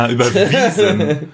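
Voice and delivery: ungläubig lachend nachgefragt. Real, not acted. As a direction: mit einem Lachen höher nachhaken. Lacht über Tolkien-Vergleich. (lachend und überrascht)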